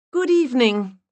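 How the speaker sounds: sweet high-pitched put-on voice. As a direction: push the voice way up and play the charming visitor. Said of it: "I raised my voice an octave and increased the strength of my accent".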